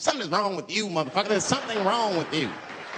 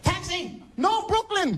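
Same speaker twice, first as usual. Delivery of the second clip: hailing again; 12 semitones higher, slower than usual. Retold or imitated. Tourist hailing a cab again, high and insistent. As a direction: wave it down harder. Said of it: "Must not have seen me".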